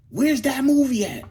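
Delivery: high, loud, exasperated question; exasperated demand. Real, not acted. Demanding the missing basketball movie.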